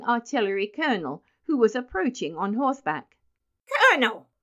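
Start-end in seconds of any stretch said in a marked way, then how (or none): 3.7-4.3 put-on high, loud boyish call; shrill cry. A narrator's reading. Benjamin hailing the colonel on horseback, shrilly. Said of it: "cried Benjamin shrilly".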